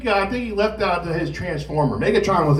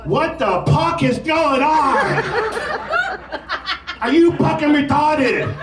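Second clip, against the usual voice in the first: drill instructor yell; 10 semitones higher, slower than usual. Retold or imitated. Drill instructor yelling at recruit in tiny raincoat.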